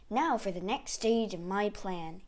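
ordinary delivery, nothing marked

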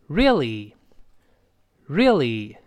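really drawn out slowly as "ree-lee"; model really. Shown as a demonstration. Teacher models the whole word for learners, really slow, both syllables clear. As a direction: curl the r, tip up the l, stretching really. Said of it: "所以读成".

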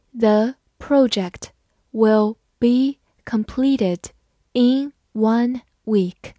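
slow, word by word; slow reading. Shown as a demonstration. Teacher reads the sentence slowly for students. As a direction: spell it out slowly for the class. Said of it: "好，慢慢来读。".

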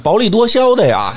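ordinary delivery

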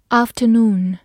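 "afternoon" in a British accent, long AH, no R; British pronunciation. Shown as a demonstration. Teacher repeats a review word for learners, British accent. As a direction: say "afternoon" the British way, opening with AHF. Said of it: "美式发音afternoon".

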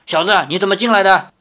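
acted scolding grandmother; stern challenge. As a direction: confront an intruder sharply. Grandmother blocks and challenges the intruder.

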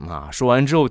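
usual speaking voice

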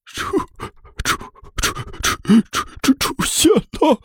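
stuttering in panic, voice leaping up; terrified stammer. Acted. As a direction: choke on the words, then shriek them out. Stammering at a rising mummy.

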